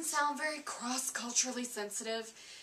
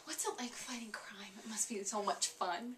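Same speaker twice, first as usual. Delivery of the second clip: breathless awed whisper; 4 semitones lower, 8 dB quieter than usual. Acted. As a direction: whisper it like a starstruck fan. Awed fan questions Spider-Man.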